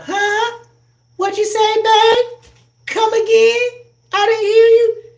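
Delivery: high, loud hard-of-hearing mama; mama who can't hear. Retold or imitated. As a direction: play a mother half deaf from church speakers, calling back. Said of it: "You wonder why your mama".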